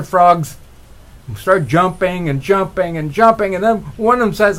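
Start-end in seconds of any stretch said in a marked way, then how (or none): none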